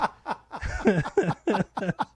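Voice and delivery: high, long laughter; laughing. Real, not acted. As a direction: laugh hard at the story. Laughs at the story.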